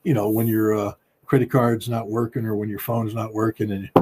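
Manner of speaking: ordinary delivery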